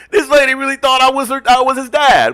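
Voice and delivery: loud, high disbelief; high-pitched, worked-up storytelling; incredulous outburst. Real, not acted. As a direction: holler it in comic exasperation. Incredulous retelling of mix-up.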